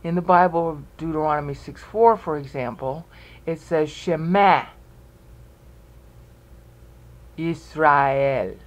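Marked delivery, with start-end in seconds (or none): 3.9-8.6 "Shema" in two syllables, she-MA, then "Yisrael" as yis-ra-EL; biblical pronunciation. Shown as a demonstration. Teacher shows the Bible's wording, "Shema" unelided as she-MA, "Yisrael" with an A. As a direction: say "Shema Yisrael" slowly with every vowel kept. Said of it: "they don't say it that way today".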